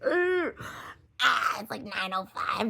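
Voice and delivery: high, pleading whine; whiny excuse. Retold or imitated. Texting the probation officer late, a high whiny excuse.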